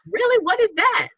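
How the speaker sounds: sudden high curious question; curious surprise. Retold or imitated. Stranger perks up at the energy drink, high and curious. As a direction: perk right up, intrigued.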